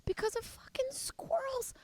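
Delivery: unvoiced whisper, high pitched. Real, not acted. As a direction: whisper it, outraged so the neighbors won't hear. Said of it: "I have to whisper because".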